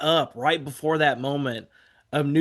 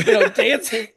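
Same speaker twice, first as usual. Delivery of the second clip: usual speaking voice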